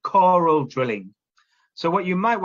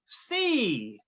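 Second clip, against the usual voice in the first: higher puppet voice giving the word C; Woody drilling; 7 semitones higher, 4 dB quieter than usual. Pretend. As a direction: model C as the puppet teacher. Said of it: "Woody's gonna take over the teacher role".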